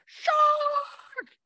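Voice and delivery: high, drawn-out mock yell; stretched shout of alarm, acted out; imitated shark alarm. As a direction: cry it out high, as a beach warning. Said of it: "Then we hear the big word".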